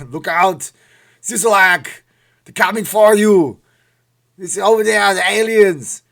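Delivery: put-on loud, high Austrian-accented Arnold voice; Arnold Schwarzenegger impression. Vlogger warns Sisolak in a loud Austrian-accented Arnold voice. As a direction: bellow the warning in a thick Austrian accent. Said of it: "when you talk like Arnold".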